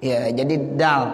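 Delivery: ordinary delivery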